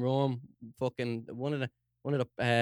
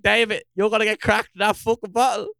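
higher and louder, warning a mate; urgent warning; 7 semitones higher, 7 dB louder than usual. Retold or imitated. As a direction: call out to David before he gets hit. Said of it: "I'm like".

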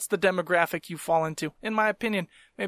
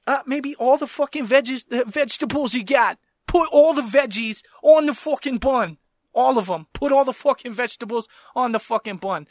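louder, higher, punching out the demand; exasperated rant; 4 semitones higher, 4 dB louder than usual. Retold or imitated. Customer telling Subway worker to use all the veggies, exasperated. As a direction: lay into an incompetent sandwich maker. Rants at the sandwich maker.

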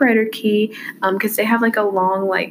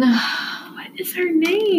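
whispered aside; 7 semitones higher, much slower than usual. Real, not acted. Searching for name aside.

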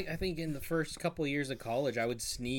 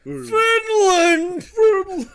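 very high, loud shrieking "Finland!"; cartoon cry; 14 dB louder, much slower than usual. Fan shrieking a SpongeBob line, hammed-up. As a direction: scream it like a cartoon fish. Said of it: "SpongeBob quote".

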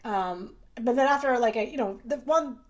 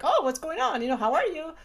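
higher-pitched, chatty hello; friendly greeting; 6 semitones higher than usual. Retold or imitated. Doctor greeting patients like friends, friendly and upbeat. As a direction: greet them like an old friend.

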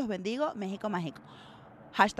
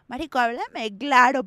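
exasperated demand; 7 dB louder than usual. Retold or imitated. Acts out blunt exasperated demand.

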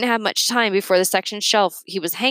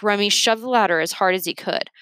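plain reading voice

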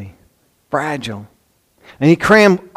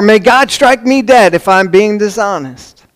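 raised, louder self-curse; mock oath; 5 semitones higher, 4 dB louder than usual. Peter invokes a curse on himself, emphatically swearing.

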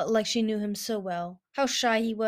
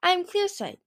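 high-pitched, harmless introduction; non-threatening; 10 semitones higher, 3 dB louder, slower than usual. A narrator's reading. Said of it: "trying to look non-threatening".

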